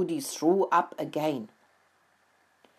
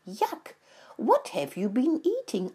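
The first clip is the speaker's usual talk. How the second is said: higher-pitched disgusted reaction; 7 semitones higher than usual. A narrator's reading. Mouse asks the sick rat what he ate, disgusted. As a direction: recoil in disgust at the smell.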